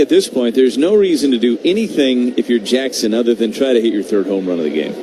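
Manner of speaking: ordinary talk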